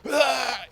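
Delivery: high, loud mock scream; sudden imitated yell of rage; imitated angry scream. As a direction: burst out screaming like the enraged cop. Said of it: "he started screaming".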